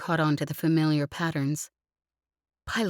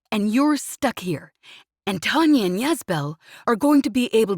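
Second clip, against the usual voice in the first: loud, higher, anguished shouting; furious rant; 6 semitones higher, 6 dB louder than usual. Acted. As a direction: pour out guilt and rage at full volume. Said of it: "he snapped furiously".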